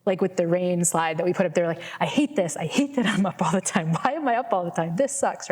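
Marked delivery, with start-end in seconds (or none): 2.0-5.5 grumbling, fed-up self-pity; mock insomniac moaning at herself; whiny complaining. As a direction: moan about lying awake at night. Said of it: "the thought pattern that would normally be going".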